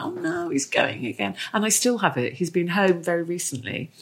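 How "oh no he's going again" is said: high, mild mock dismay; understated little wail of parting; pang of dismay. Mum seeing her son leave for uni, mild dismay. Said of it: "that pang of like".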